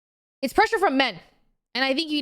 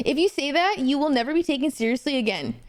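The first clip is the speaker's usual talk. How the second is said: ordinary talk, nothing marked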